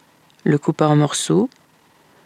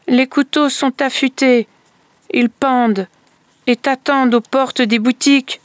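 higher calling voice, half-chanted warning; crying out a warning; 8 semitones higher than usual. Acted. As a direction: call out to the brother far off, pitched higher. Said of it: "La fillette sortit et cria".

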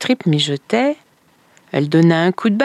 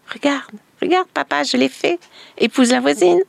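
a lightly voiced small girl, higher and eager; a child showing off to her father, higher; little girl's voice; 9 semitones higher, faster than usual. Little girl prompted by neighbour.

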